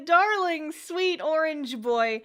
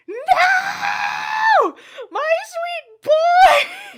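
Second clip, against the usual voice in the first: high, loud drawn-out wail; slow, piercing cry of despair; anguished scream; 11 dB louder, much slower than usual. Retold or imitated. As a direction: scream it as if heartbroken. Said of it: "I screamed at this part".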